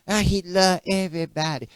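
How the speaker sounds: a hint of a careless churchgoer shrugging; mildly mocking voice for popular belief; mocking the people. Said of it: "all you always hear the people say".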